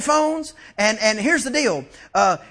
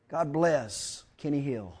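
quiet aside; 11 semitones lower, 11 dB quieter, slower than usual. Real, not acted. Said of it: "I said".

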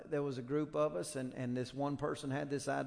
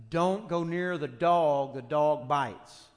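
booming, slow sign warning, every word weighted; loud slow warning; 8 dB louder, slower than usual. Pretend. Preacher booming out the warning sign to listeners, loud and slow.

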